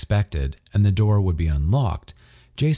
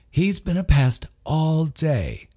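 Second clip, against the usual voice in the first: higher, slow, lightly voiced wife; a fond complaint about her husband; Emily voice; 9 semitones higher, slower than usual. A narrator's reading. Wife teasing guests about her husband, slow and light.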